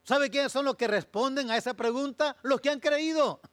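loud, high pulpit shouting; full-force preaching. Acted. Pastor declaring who truly believes, loud and high. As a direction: drive it home to the congregation.

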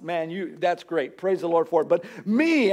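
ordinary talk

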